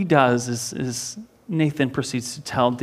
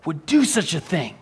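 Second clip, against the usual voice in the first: higher and louder, outraged king demanding justice; enraged outburst; 9 semitones higher, 5 dB louder than usual. Retold or imitated. King David, hearing the prophet's story, furious outraged shout. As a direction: blurt it out in rising fury, demanding the culprit's name. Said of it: "he was enraged".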